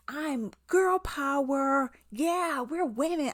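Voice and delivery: drawn-out, high mock cheer; mock girl-power cheer. Reviewer mocking the movie's girl power message, hammed-up and high. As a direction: parody a self-congratulating girl-power slogan. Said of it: "but this whole".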